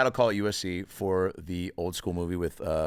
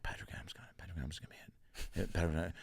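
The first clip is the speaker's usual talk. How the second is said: whispered rumour passed around, very soft; hushed gossip; 3 semitones lower, 13 dB quieter, much faster than usual. Retold or imitated. Classmates buzzing about Patrick, hushed whisper. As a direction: murmur it like excited campus gossip.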